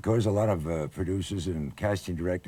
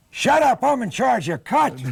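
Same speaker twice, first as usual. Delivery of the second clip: much higher and louder "Shut up"; yelled line; 7 dB louder than usual. Retold or imitated. Nervous rookie actor shouting his line at Tracy, loud. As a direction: jump up and shout it.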